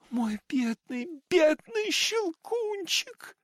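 very high, slow sobbing cry; crying out in sobs. A narrator's reading. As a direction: wail it out through tears. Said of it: "рыдая, закричала она". (рыдающий крик)

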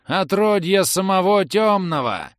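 высокий протяжный клич рыцаря; обличать врага, скорбно голося; скорбный вопль. A narrator's reading. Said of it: "скорбно возопил скачущий к нам". (горестно, нараспев возопить)

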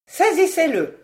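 high, loud command; shouted order. A narrator's reading. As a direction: yell at the soldiers to seize him. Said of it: "s'écria Béchameil".

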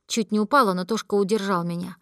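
usual speaking voice

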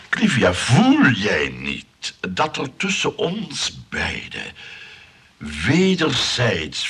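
slow, loud, grandly drawn-out declaration; pompous declaration. Acted. Johan pleading with Clivia about mutual love, slow and pompous. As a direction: lay it on thick like a ham lover.

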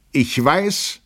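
halting start of announcement. A narrator's reading. Lieutenant begins before the staring guests, halting announcement. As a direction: begin a declaration you cannot yet finish. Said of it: "begann er".

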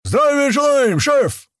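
very high, loud drawn-out salute; shouted military response. A narrator's reading. Young fighters answer their chief's greeting, shouted salute. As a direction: roar it like soldiers answering in chorus. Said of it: "Хором ответили стоящие напротив меня".

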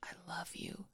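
breathy, voiceless whisper; an intimate whispered confession. A narrator's reading. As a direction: barely breathe the words to him. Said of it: "he wanted to whisper".

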